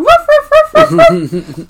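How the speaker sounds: very high, loud puppy yaps; little dog barking. Retold or imitated. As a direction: yap rapidly like a tiny dog. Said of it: "like a little dog going".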